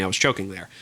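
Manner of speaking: normal talking voice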